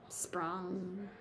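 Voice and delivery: soft, drawn-out boing word; springy bounce. Acted. Sound word in picture book.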